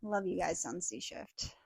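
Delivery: normal talking voice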